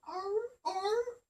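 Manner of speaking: playful imitated beagle barks; imitated dog bark. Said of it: "and he'd go".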